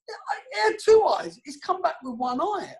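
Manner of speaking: high, outraged pet shop owner voice; indignant shopkeeper. Retold or imitated. Pet shop owner scolding the player, high outraged voice.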